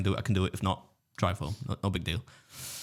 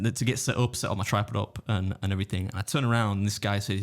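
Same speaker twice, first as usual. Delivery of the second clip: ordinary talk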